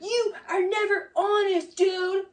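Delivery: ape his shouting at her; mock yell. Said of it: "he yells at her".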